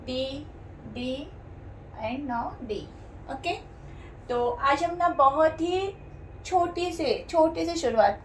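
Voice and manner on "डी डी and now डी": softer, spoken gently; soft repetition. Shown as a demonstration. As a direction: repeat the letter softly to stress it. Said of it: "last में डी add है". (डी को धीरे-धीरे नरमी से दोहराना)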